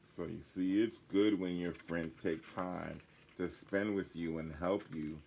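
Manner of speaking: ordinary delivery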